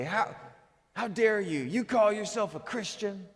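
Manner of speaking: mock indignant preaching, pitched high; soapbox rant. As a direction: act out a righteous scolding without raising the volume. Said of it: "get on his soapbox and say".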